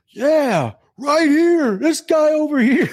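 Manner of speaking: very high, drawn-out call across the room; buddy volunteering him. Retold or imitated. Buddy volunteering his friend from the crowd, high-pitched calling out. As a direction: imitate a friend hollering up to the stage. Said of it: "my buddy goes".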